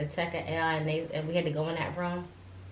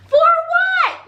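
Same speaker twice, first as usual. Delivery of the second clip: much higher, loud shocked cry; shocked outcry; 12 dB louder than usual. Retold or imitated. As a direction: cry out in disbelief. Retells her shock at being sent to ER.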